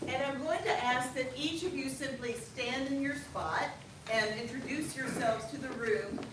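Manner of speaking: ordinary talk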